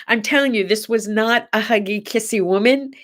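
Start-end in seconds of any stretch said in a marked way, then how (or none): none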